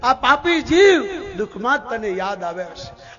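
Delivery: loud opening cry, easing after each; impassioned reproach. Retold or imitated. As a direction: hit each address to the soul hard. Kunta rebukes the forgetful human soul.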